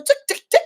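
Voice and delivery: high ticking like a stuck needle; mock record skip. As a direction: tick it out like a scratched record. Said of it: "scratching the fucking needle".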